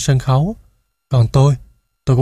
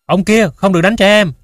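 raised, high-pitched indignant call; loud cry; 6 semitones higher, 4 dB louder than usual. A narrator's reading. Audience member yells at actor to stop hitting child, loud cry. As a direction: shout out in protest, higher and louder. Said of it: "tôi đứng bật dậy kêu to".

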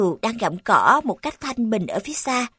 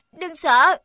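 put-on brave boy voice; 6 semitones higher, 4 dB louder than usual. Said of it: "Mithiel nói, cố hết sức bảo vệ em mình".

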